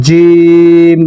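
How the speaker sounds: জিম with the i held long; sustained recitation-style জিম; drawn-out jim. Shown as a demonstration. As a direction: stretch জিম for three counts. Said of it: "এটি তিন আলিফ পরিমাণ টেনে পড়তে হবে".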